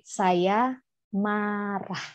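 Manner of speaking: very slow, soft naming of the feeling; slow recognition. Retold or imitated. Child naming his anger, very slow and soft. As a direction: let it dawn on you slowly. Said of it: "dia mulai mengenali".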